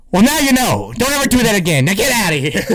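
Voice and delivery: high-pitched imitation of a scolding officer; mock cop. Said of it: "And the officer is like".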